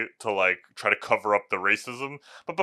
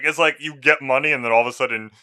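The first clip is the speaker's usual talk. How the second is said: ordinary delivery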